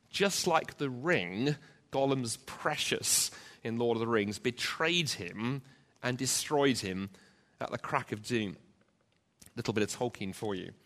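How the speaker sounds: ordinary delivery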